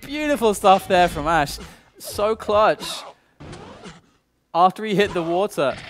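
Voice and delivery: loud, high, hyped play-by-play; esports caster. Acted. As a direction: scream the clutch shot to the crowd. Excited live play-by-play.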